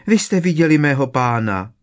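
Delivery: high, loud blurted question; breathless house-elf. A narrator's reading. Winky the elf asks after her master, blurting breathlessly. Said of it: "vyhrkla Winky bez dechu".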